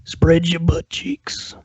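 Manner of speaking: hammed-up menacing whisper to the troll; mock creepy whisper. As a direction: whisper it like a fake creep, slowly. Mock threat answering a chat troll.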